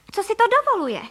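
very high, loud outraged question; indignant cry. A narrator's reading. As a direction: let the pitch soar in affront. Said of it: "vykřikla paní Frejková".

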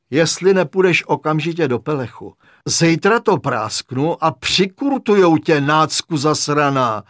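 loud, emphatic warning; angry threat. A narrator's reading. Patient threatening the intruder with restraints, angry threat. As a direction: threaten with slowing, weighty insult.

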